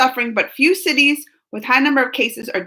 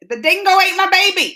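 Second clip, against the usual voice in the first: put-on a first try at an Aussie accent, pitched higher; an amateur Australian twang on a famous line; a hammed-up practice Aussie voice; 7 semitones higher than usual. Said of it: "do I sound like an Australian?".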